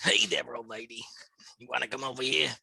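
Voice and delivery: put-on low, husky old lady whisper. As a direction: croak it like a lecherous old granny. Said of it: "five minutes of old lady voice".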